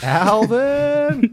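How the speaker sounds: high, drawn-out comic call; high-pitched mock holler. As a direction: call the name like a hammed-up summons. Mock calling out to Alvin the chipmunk.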